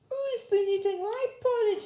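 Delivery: put-on very high, tiny Baby Bear voice; teeny wee voice. A narrator's reading. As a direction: squeak it like a little bear cub.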